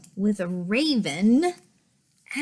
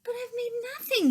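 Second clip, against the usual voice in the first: high little bear voice; 13 semitones higher, much faster than usual. Acted. Bear's sad line in story.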